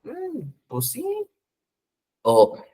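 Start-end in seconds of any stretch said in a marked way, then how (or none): 0.0-1.0 soft, slow musing with a high "mm"; acted hesitant self-answer, much softer; pondering. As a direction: think it over, then quietly answer yourself. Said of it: "se ponen a pensar y dicen".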